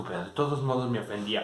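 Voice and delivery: ordinary delivery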